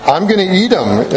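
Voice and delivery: high, laughing through the words. Real, not acted. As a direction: laugh while admitting it. Joking about eating candy.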